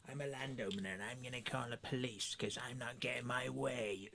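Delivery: soft, sneering mock of an entitled landowner; sneering landowner imitation. Mocking the landowner who threatened police, quiet sneer. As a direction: mimic her smugly, under your breath.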